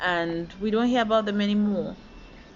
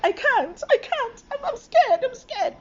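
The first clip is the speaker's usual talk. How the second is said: very high, frightened plea; scared pleading; 4 dB louder than usual. Retold or imitated. Learner driver pleading with the evaluator, high and scared. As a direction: squeak out that you can't do it. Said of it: "And I'm like".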